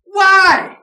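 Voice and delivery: one high, loud cry; sustained shouted question; shouted why. Real, not acted. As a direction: hurl the question at the congregation. Lone climactic question.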